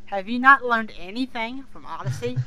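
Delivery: ordinary delivery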